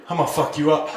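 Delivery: low, deadpan threat; dead-faced threat. Retold or imitated. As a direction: threaten with all expression drained out. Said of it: "they just go dead face".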